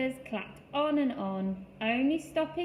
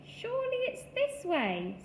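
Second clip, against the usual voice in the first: high animal voice; 13 semitones higher than usual. A narrator's reading. Another animal voice.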